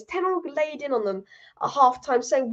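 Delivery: usual speaking voice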